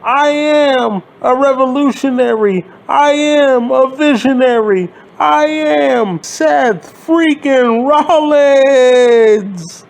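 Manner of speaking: pompous self-hype, parodied; mocking Rollins promo. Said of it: "he says some stupid shit about".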